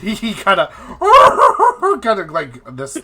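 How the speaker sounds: goose-like honking cackle; honking laugh. Shown as a demonstration. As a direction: honk out a high, loud laugh. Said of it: "I'm trying to do, like, a honking laugh".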